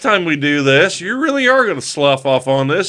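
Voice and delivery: high, loud mock tirade; mock scolding. Dad cursing at his grinning son, mock tirade. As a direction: play-act the fed-up father.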